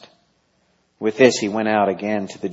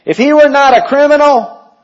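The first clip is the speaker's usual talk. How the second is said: much higher, loud accusing crowd; voicing the accusers; 12 dB louder than usual. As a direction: shout it up high like the angry crowd. Crowd voiced in reading.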